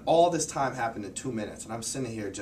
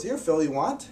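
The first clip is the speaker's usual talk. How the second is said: imitated teacher, higher-pitched casual offer; 7 semitones higher, 4 dB louder than usual. Teacher offering a caught pigeon to a student, imitated eager offer. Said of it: "he offers it to me".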